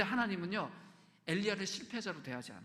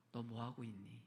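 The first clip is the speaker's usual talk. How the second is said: soft, tender scolding question; gentle rebuke; 6 semitones lower, 8 dB quieter than usual. Acted. God gently rebukes his people, soft and tender. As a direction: chide softly like a loving father. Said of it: "부드럽게 꾸짖으세요".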